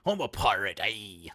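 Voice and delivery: put-on loud, drawn-out pirate accent; hammed-up pirate. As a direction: announce yourself as a stage pirate. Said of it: "I said it as a pirate".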